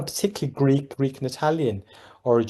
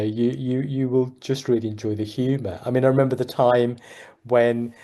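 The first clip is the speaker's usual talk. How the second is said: normal talking voice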